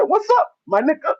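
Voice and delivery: high-pitched impression of a clueless copycat; Jackie. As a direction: imitate an innocent newcomer copying slang. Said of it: "Jackie came back to the bar".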